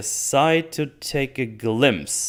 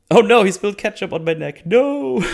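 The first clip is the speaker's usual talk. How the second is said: high, hammed-up wail of dismay; mock dying victim; 9 semitones higher, 3 dB louder than usual. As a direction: wail in fake horror, laughing at the end. Mocks the dying elf.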